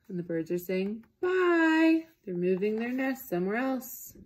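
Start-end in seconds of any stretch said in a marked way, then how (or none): 1.2-2.1 high, cheery little bye; chirpy bird farewell. A narrator's reading. Birds saying bye to elephant, high and cheery. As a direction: wave off sweetly.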